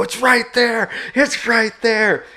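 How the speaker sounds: high, loud pointing-out shout, stretched on the repeat; retold urgent yell. Hunter spots bear for his buddies, urgent high yell. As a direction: shout it urgently to buddies who can't see it. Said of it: "I'm yelling".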